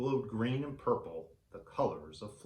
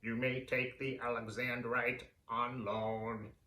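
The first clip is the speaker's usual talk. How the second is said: alien voice. Acted. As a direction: speak like a calm being from another world. Alien grants the loan.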